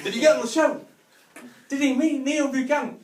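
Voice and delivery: put-on high, loud, eager kid; excited child. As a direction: ask it like a thrilled boy.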